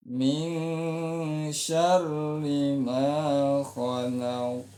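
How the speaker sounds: Min syarri ma khalaq chanted slowly on one level note. Shown as a demonstration. Teacher demonstrating the flat second-verse tone. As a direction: hold khalaq flat, do not raise it. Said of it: "kalau ini datar".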